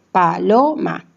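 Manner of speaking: "paloma" broken as pa, lo, ma; syllable by syllable. Shown as a demonstration. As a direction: pause between each syllable of "paloma": pa-lo-ma. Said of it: "formado por una sola consonante".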